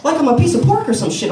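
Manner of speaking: usual speaking voice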